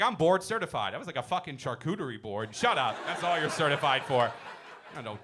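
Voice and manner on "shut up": ordinary talk, nothing marked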